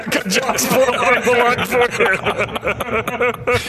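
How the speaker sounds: high, laughing mock offer; laughing tease. PR guy teasing the reporter, laughing. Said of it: "still ask me out".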